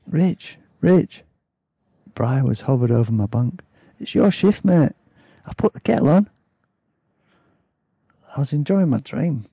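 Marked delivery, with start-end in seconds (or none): 0.1-1.2 raised, urgent Yorkshire-style wake-up call; Bry. A narrator's reading. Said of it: "Can't even do a Yorkshire accent". 4.0-6.3 high-pitched attempted Yorkshire accent; Bry. A narrator's reading. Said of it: "Can't even do a Yorkshire accent".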